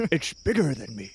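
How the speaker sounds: usual speaking voice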